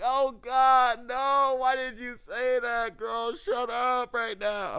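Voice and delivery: high, loud, drawn-out comic dismay; exasperated pleading. Acted. Man silently begging his friend to shut up, exasperated. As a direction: wail at her to stop talking. Acts out dismay at her remark.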